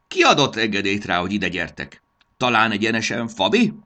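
loud, demanding gnome voice; indignant gnome. Acted. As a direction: fire accusing questions at trespassers. Gnome interrogates the intruders. (felháborodott, hangos, számonkérő manó)

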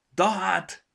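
high, loud stuffed-nose outburst; sudden high, blocked-nose surprise; startled exclamation. A narrator's reading. Stuffed-up gnome startled after sneezing.

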